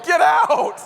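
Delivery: high, loud yell at the bird; panicked yell at a bird in the car. Retold or imitated. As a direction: shout it out in a panic. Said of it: "I kept yelling".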